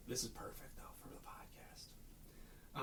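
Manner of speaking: soft whispered aside. Real, not acted. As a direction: whisper it as a secret bonus. Whispered aside about the podcast.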